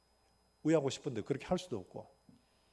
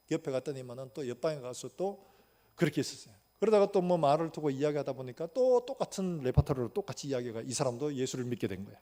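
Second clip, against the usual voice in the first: ordinary talk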